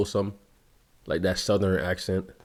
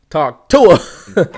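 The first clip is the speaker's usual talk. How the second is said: mock shout; 6 semitones higher, 11 dB louder, slower than usual. Mocking the video's line.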